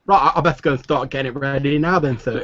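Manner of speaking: put-on high eager boy voice; young stable boy.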